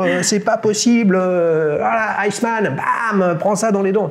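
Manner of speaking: high-pitched game-show exclamation; hyped-up congratulation. Real, not acted. Host celebrates the squadron pilot's right answer, hyped up. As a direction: cheer the winning answer, drawing words out.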